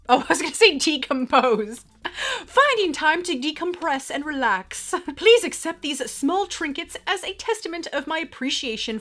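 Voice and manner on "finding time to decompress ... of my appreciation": raised, formal mock-English accent; Jane Austen-style English accent. Vlogger resuming a fan's letter, Austen-era English accent. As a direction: read it with old-time English pomp and accent. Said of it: "you totally speak like, like, um, the Jane Austen times".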